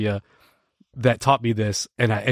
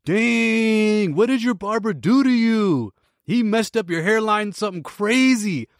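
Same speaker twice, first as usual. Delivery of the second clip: mocking kid's voice, pitched higher; playing the cheeky drive-thru kid; smug teenage taunt, voiced higher; teen cashier roast; 8 semitones higher than usual.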